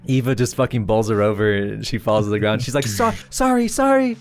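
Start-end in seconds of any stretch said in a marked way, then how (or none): 2.9-4.2 high-pitched, hurried acted apology; mock flustered, way up high. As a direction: squeak out sorries on the run. Acts Eva apologizing mid-run.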